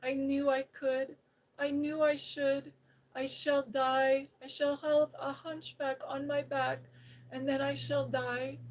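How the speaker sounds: high loud wailing despair; wailing terror. Acted. Colin wails to Mary he will die. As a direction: wail as if doomed. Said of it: "sobbed and wailed".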